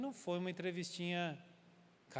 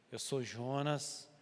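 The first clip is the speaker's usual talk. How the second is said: slow, grave admission; solemn confession; 3 semitones lower, much slower than usual. Retold or imitated. Jonah confessing to the sailors who he serves, slow and grave. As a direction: let each word weigh.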